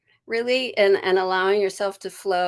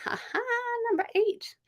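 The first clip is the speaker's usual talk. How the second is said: higher, softer giggly delight; playful excited giggle; giddy; 5 dB quieter than usual. Real, not acted. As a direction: giggle with glee. Giddy at card number.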